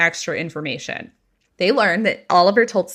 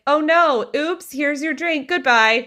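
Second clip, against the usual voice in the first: mock innocent oops; 8 semitones higher, slower than usual. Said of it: "Bailey's like".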